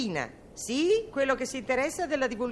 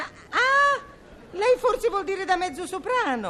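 sudden realization; 7 semitones higher than usual. Acted. Realizing he means mezzo soprano.

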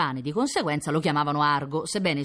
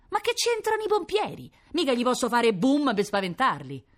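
protesting waiter; 10 semitones higher than usual. Acted. Waiter objecting.

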